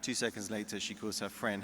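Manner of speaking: ordinary delivery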